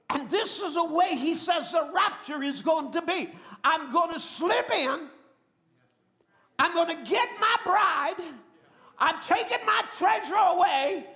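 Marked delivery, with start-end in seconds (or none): 3.6-11.0 voicing the Lord. Voicing the Lord's promise.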